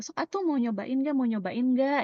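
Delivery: acted coaxing offer. Offering people a sample, coaxing and upbeat. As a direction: coax them to try it. Acting an offer in Sundanese style.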